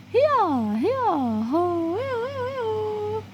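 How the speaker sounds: high drawn-out herder's cry; lilting high "hia" calls; answering herding call. Acted. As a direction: echo the call back across the field.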